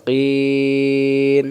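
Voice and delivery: কিন with the vowel held very long; three-alif stretch. Shown as a demonstration. Teacher showing the long vowel in কিন. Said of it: "ওখানে কিন্তু তিন আলিফ আছে".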